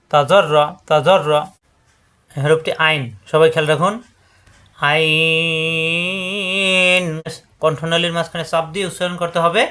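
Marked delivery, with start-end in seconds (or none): 4.9-7.0 আইন held and chanted for four alif counts; long drawn ain. Shown as a demonstration. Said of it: "শুধু হরফ চার আলিফ পরিমাণ টান হবে". (আইন চার আলিফ টেনে সুর করে)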